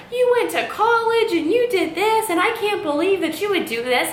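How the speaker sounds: high, shrill mimicry of mom; mocking mother. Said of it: "she's like".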